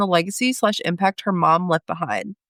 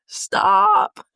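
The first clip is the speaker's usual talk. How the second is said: high, louder, half-sobbing laugh; crying laugh; 10 semitones higher, 3 dB louder than usual. Real, not acted. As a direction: break into a laugh-cry on the word. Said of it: "I'm, like, a little emo".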